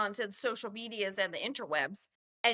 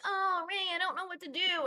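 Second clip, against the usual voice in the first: mock pleading girl; 7 semitones higher than usual.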